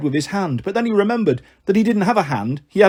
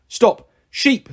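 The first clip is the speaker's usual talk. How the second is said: high, commanding goat guard; pompous sentry's raised voice; big, important voice; 6 semitones higher than usual. A narrator's reading. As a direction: call it out with great self-importance. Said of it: "in a very big, important voice".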